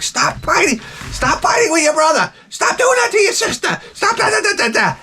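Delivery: high-pitched mock shouting at the kids; frazzled mom yelling. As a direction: screech like a frazzled mother losing it.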